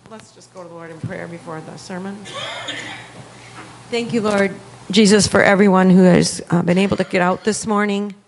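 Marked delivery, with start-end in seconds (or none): none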